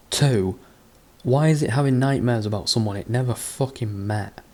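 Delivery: ordinary delivery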